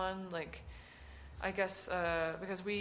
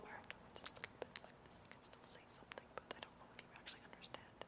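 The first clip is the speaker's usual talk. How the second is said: very faint, fast, half-said whisper; unintelligible whisper; 19 dB quieter, much faster than usual. Shown as a demonstration. Artist demonstrates whispering that slips toward unintelligible, barely-there fast whisper. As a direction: whisper so quickly and softly the words blur. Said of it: "it can go into unintelligible".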